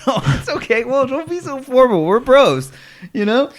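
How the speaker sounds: ordinary talk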